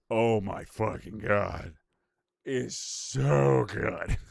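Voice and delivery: very slow, drawn-out raving; savoring rapture. Real, not acted. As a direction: draw every word out as if savoring it. Raving about real maple syrup.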